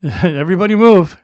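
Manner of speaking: loud emergency call; urgent shout. Pretend. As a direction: clear the aisle, loud. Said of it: "They're like".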